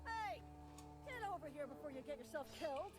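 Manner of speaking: high-pitched call to someone away; urgent calling out. Acted. Guard to stranger about to get killed, urgent calling out.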